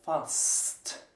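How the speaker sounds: fast with the s and t said apart; whisper-clear s then t in fast; fast, s and t pronounced individually; clean s-t. Shown as a demonstration. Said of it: "We pronounce them individually".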